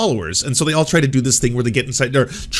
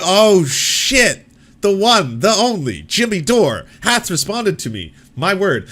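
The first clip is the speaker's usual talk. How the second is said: high, loud, grandiose mock reverence; mock awe; 7 semitones higher, 4 dB louder, slower than usual. Mocking his own reaction to Jimmy Dore's response. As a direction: play it like royalty has deigned to reply. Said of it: "And I was like".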